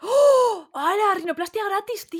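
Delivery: high, excited gasp of delight; gasp, then marvel at the freebie; amazed. Real, not acted. Reacts to offer of free rhinoplasty. (asombro agudo y entusiasmado)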